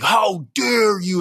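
hammed-up "How dare you", slow like a scolding coach; angry football coach voice. Pastor scolding men who were mean to women, mock coach growl. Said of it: "like, angry football coach voice".

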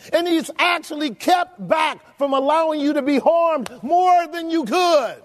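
rising preacher's rebuke; full-force preaching. Acted. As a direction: press them with each question. Preacher presses the congregation.

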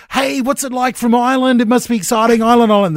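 high-pitched, gushing enthusiasm in mock quote; eager fan. Host mimicking the team quizzing the band crew, eager and high.

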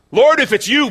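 loud, high call across the water. Acted. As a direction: call up to the Lord at full voice. Peter calling to Jesus on water.

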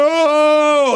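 high panicked shout of no; loud cry. Retold or imitated. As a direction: yell no in horror. Panicked outcry at friend.

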